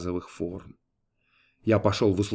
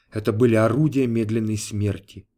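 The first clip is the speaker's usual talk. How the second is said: ordinary delivery, nothing marked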